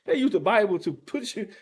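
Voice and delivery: ordinary talk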